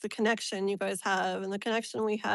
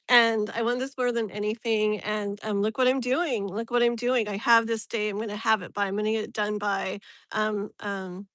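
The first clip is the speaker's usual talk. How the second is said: ordinary talk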